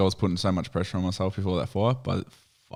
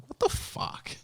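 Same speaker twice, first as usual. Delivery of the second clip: hushed disbelief; 7 dB quieter, slower than usual. Retold or imitated. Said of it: "I'm like".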